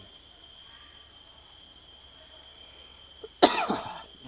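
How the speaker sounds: a real, rough coughing fit; harsh coughing fit. Real, not acted. Coughing hard while filming, friend remarks on it. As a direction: cough hard and long, unable to stop. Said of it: "Grabing ubo, Dol".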